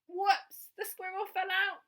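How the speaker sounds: put-on lively read-aloud for children; storytelling. A narrator's reading. Storyteller reading a picture book aloud to young children. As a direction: tell the bedtime story with bright expression.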